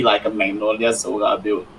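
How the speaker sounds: ordinary talk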